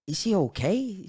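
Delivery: retold worry, high and drawn out; anxious question. Asking grandma whether she survived, anxious.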